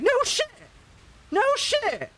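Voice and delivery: very high, loud delighted shout; excited shout. Retold or imitated. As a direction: yell it in sheer delight. Excited guy hears streamer job.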